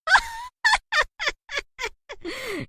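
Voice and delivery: high evil witch cackle. Acted. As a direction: cackle with glee over the captives. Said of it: "हंसने लगती है". (चुड़ैल की डरावनी हंसी)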